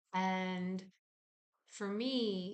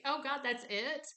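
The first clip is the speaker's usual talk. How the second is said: higher, surprised "that's it"; 6 semitones higher than usual. Retold or imitated. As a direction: sound amazed it's so little. Reaction to body's simple wishes.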